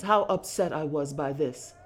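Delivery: ordinary delivery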